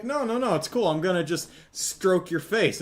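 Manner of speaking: mock-sweet woman insisting; high insistent lady. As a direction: coax the horse, high and eager. Woman insisting on petting horse.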